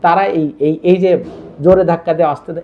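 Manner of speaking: usual speaking voice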